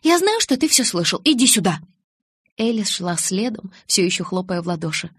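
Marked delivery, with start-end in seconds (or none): 0.0-1.8 high, then loud command; raised, demanding voice; angry summons. A narrator's reading. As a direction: call the eavesdropper out crossly. Bella angrily summons eavesdropping Edward.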